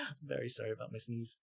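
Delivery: ordinary talk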